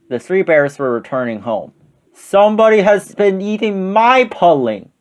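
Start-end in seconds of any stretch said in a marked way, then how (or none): 2.1-4.9 big, high-pitched Papa Bear boom; great big voice. A narrator's reading. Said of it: "in his great big voice".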